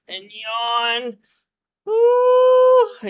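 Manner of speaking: high loud yawning howl; wolf yawn sound. Retold or imitated. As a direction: stretch into a big dog yawn. Voicing the wolf's yawn.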